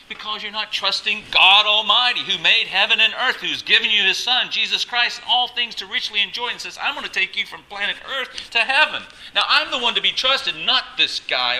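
high, loud, sustained exhortation; impassioned preaching. Real, not acted. Preacher answering why horoscopes are wicked, impassioned shout.

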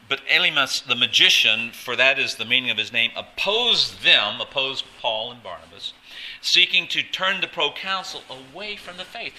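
preaching voice. Acted. Pastor reading Scripture aloud to the congregation, preaching voice. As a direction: proclaim it loudly like a preacher. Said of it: "that's your pastor's voice".

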